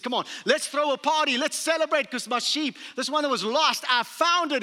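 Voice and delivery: shepherd calling his friends with excited joy. Retold or imitated. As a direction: call the friends in with overflowing joy. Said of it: "And he's so excited".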